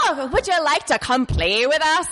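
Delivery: put-on high sing-song Welsh girl voice; Welsh accent. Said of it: "I'm gonna try to do a Welsh accent".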